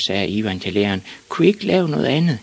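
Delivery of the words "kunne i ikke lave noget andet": put-on high, quick child's voice; high-pitched little-boy request; pleading small boy. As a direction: ask hopefully like a schoolboy. Ivan pleads with teacher.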